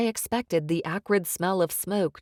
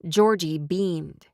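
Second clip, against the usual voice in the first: ordinary delivery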